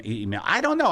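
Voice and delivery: ordinary talk, nothing marked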